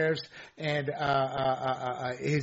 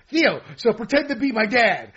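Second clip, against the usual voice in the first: slightly higher, louder skit retelling; lightly acted comedian; 5 semitones higher, 7 dB louder than usual. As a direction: lightly play the eager comedian setting up a bit. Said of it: "He goes".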